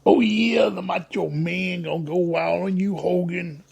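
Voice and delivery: channel a wild pro wrestler promo; a fair stab at the Macho Man; Macho Man impression. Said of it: "I do voices like that sometimes".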